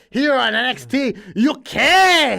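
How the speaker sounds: loud, high Scottish promo shout; mock Scottish accent. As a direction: yell it like a hammy Scottish promo. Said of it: "That's how they say it on the show".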